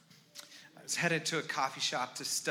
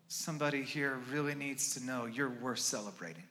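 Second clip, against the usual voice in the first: usual speaking voice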